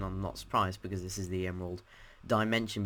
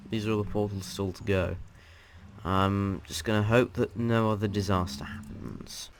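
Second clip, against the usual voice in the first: usual speaking voice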